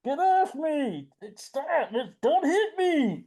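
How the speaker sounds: high, drawn-out mock cries; panicked Frogman. Frogman to his attacker as he is tackled, mock panicked cries. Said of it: "Frogman's like".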